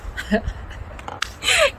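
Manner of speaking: a light, airy laugh at herself; amused little laugh at the absurdity; breathy high laugh. Real, not acted. Laughing after her own story.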